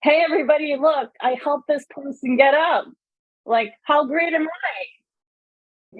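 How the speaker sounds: high, showy self-congratulating proclamation; boastful announcement. Retold or imitated. Larry bragging to the news about helping, high and showy. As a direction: crow it out to the press, slowing at the end. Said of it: "he calls the news and says".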